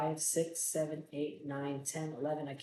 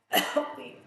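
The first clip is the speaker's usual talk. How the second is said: higher, strangled wheeze; wheezed plea; 8 semitones higher, 5 dB louder than usual. A narrator's reading. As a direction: wheeze it out like a choking victim. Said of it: "Max wheezed".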